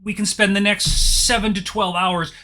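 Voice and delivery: loud, high, pompous lawyer demanding his client; blustering lawyer. Acted. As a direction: bellow it like an indignant attorney. Lawyer threatens injunctions.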